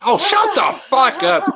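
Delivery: 1 mock outrage. Real, not acted. Mock outrage at being called Canadian.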